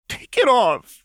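very high, loud desperate sob; sobbing cry. A narrator's reading. Jinx begging Lux to remove the arm, sobbing loudly. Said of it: "Jinx sobbed".

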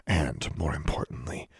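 ordinary delivery, nothing marked

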